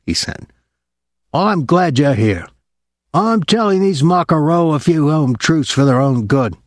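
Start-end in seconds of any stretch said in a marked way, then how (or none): 1.3-6.6 lightly voiced rowdy sailor; a hint of boozy swagger; brash drunk sailor voice. A narrator's reading. Pinky the drunk sailor speaks.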